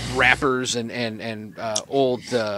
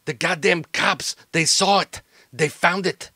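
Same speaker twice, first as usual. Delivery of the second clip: rattled henchman reporting a botched job; stammering, cursing exasperation, bursts of volume; agitated, stumbling bad-news report; agitated criminal associate; 4 semitones higher, 8 dB louder than usual.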